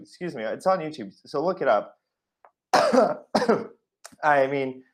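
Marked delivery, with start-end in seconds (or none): 2.7-3.7 throat-clearing cough, higher pitched; choked-up cough. Real, not acted. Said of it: "I'm already getting choked up".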